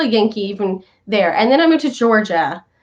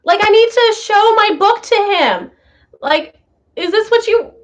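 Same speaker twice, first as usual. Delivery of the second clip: higher, puzzled little voice; a lost new girl thinking aloud, higher; confused new girl; 8 semitones higher than usual. Retold or imitated. New girl wondering what the teacher means, puzzled and higher.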